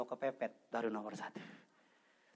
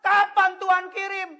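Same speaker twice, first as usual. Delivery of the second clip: high, very loud imitated plea; hammed-up shouted demand to heaven; mock shouting prayer; 11 semitones higher, 15 dB louder than usual. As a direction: cry out to God in mock desperation. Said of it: "bukan berteriak".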